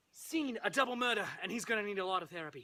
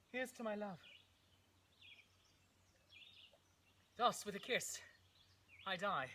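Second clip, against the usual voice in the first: soft, halting last words; dying words; 9 dB quieter than usual. Acted. Romeo drinks poison for his love, soft dying words. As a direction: fade out softly with long pauses.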